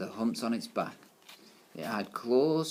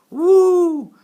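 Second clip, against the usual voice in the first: crowd whoop; 19 dB louder than usual. A narrator's reading. Class cheering.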